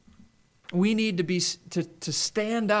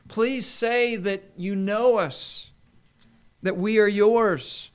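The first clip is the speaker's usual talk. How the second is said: higher, slow, begging plea; pleading believers; 4 semitones higher, slower than usual. Retold or imitated. Preacher voicing false believers begging the Lord, pleading. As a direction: beg the Lord to know you. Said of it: "It's kinda like".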